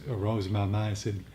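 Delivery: ordinary talk, nothing marked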